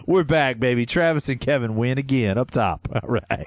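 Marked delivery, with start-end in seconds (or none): none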